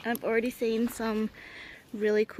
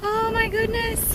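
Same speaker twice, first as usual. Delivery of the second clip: awed; 11 semitones higher, 3 dB louder, slower than usual. Real, not acted. Awed by the view on ridge.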